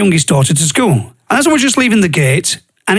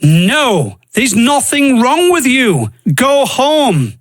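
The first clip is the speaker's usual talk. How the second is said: doctor's firm dismissal; 5 semitones higher, much slower than usual. Retold or imitated. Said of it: "she goes".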